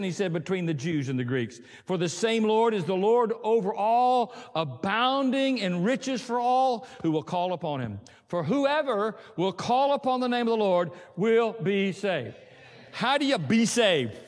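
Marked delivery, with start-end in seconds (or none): 1.8-12.3 slower, weighty scripture read; deliberate Bible reading. Acted. 12.9-14.2 slow, louder preacher's challenge; fervent sermon question; rousing question. Real, not acted. Preacher's rising climax.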